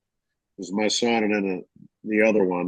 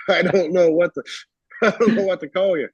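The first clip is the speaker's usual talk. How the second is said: laughing; 8 semitones higher than usual. Retold or imitated. Retelling embarrassed young man.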